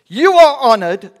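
loud, high mock acclaim; sarcastic. Paul mock-praising the people, loudly sarcastic. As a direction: hail them with exaggerated honour, voice soaring. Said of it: "can be quite sarcastic with the people".

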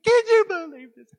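very high, loud squeal of disbelief; incredulous shriek. Retold or imitated. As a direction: shriek it in mock hysterics. Phone call about lost bumper.

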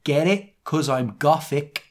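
slow, deliberate mock dedication; author's note read-out. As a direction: land the bad pun very deliberately. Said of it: "This is the author's note".

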